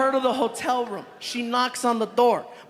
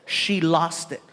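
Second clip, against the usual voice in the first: normal talking voice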